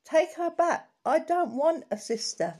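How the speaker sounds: put-on high, loud childish outburst; screamed protest. A narrator's reading. Child told of a new sister, screamed out loud. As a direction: yell it out in indignant refusal. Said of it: "I screamed out loud".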